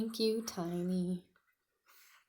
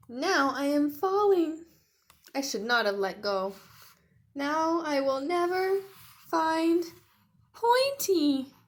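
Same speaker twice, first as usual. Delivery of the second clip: much higher, louder mock panic rising; Tiny falling; 7 semitones higher, 10 dB louder than usual. Tiny falling from the sky, mock panic. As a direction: wail it out as you fall.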